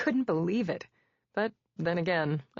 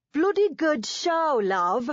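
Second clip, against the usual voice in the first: high, loud, slow hammed-up British accent; mock-posh British accent, played for laughs; Monty Python British accent; 9 semitones higher, 5 dB louder, slower than usual. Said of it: "doing my best Monty Python imitation".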